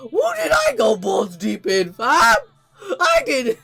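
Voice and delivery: high, loud incredulous outburst; hammed-up yell of disbelief; comic shock. Acted shocked reaction of Tanjiro.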